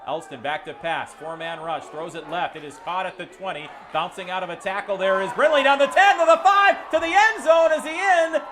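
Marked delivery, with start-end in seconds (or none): 3.9-8.4 touchdown call, rising to a yelling peak; excited play-by-play. Acted. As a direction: call the scoring run, building higher and louder. Touchdown call at full force.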